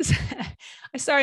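breathy teary laugh into a whispered apology; emotional. Real, not acted. Host tearing up while telling guests' story, whispered apology. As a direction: choke up and whisper the sorry. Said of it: "I'm getting emotional".

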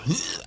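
short high-pitched retch; imitated gag. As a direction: act out gagging at something gross. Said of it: "I was gagging. Like my aunt".

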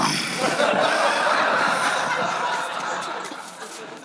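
long soft unvoiced laughter; breathy laugh. Real, not acted. Preacher laughs at his own cartoon joke, soft breathy laugh. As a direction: laugh quietly at the punchline.

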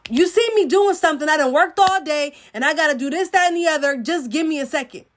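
hammed-up fed-up spouse; irritated snapping back. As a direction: rattle off grievances at your partner. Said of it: "Look how you just talked to him".

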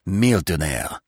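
ordinary delivery

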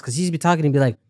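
normal talking voice

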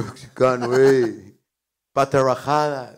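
mock American accent. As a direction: taunt in a hammed-up American accent. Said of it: "Es como hablan esos güeyes". (imitando acento estadounidense, burlón)

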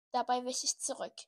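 ordinary delivery

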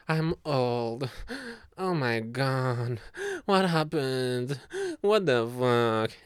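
put-on slow, loud dismayed old voice; hammed-up aging dread; mock panic. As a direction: drag out the horror. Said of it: "rather than".

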